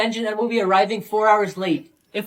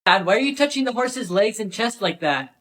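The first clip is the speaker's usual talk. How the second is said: ordinary talk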